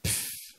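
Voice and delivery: dismissive unvoiced pfff; scornful sigh. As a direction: blow out air in contempt. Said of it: "puis il fait".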